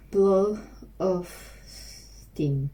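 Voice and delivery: very slow, stretched out; slow practice drill. Shown as a demonstration. Learner drilling the phrase to herself, very slow and drawn out. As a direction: drag each word out like a drill. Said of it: "repeating phrase slowly for practice".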